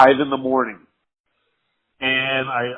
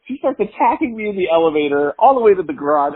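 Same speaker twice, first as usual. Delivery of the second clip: normal talking voice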